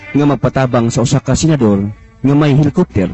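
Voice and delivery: ordinary delivery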